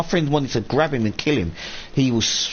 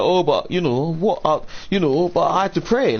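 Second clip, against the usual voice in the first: mocking the roommate; 7 semitones higher than usual.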